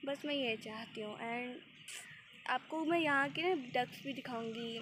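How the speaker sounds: ordinary delivery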